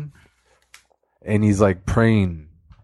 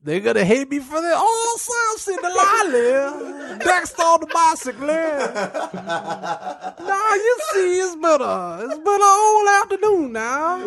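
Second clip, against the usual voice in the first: high laughing cry; 14 semitones higher than usual. Pretend. Narrator character breaks down.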